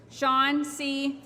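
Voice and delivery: ordinary talk, nothing marked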